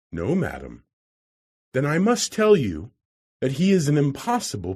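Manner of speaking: a hint of a fretful wife warning the visitor; worried lady. A narrator's reading. Narrator voices the worried lady.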